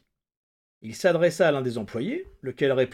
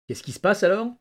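lightly hoarse, higher-pitched question; mildly husky, raised questioning voice; 6 semitones higher, 3 dB louder than usual. A narrator's reading. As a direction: ask with a touch of hoarseness. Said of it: "d'une voix rauque".

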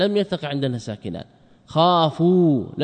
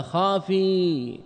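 خافي stretched very slowly, a little higher; drawn-out word; 5 semitones higher, much slower than usual. Shown as a demonstration. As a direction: draw out خافي like a quoted command. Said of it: "فقل لها". (خافي ممدودة ببطء كأمر)

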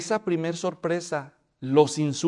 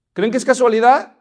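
louder question, rising on casualidad; loud repeat; 5 semitones higher, 9 dB louder than usual. Shown as a demonstration. Preacher repeats the question loudly to the congregation. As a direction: push the question harder.

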